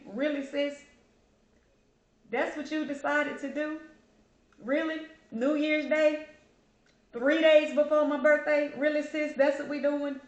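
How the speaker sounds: mock disbelief. Woman chiding her late sister to keep from crying, mock disbelief.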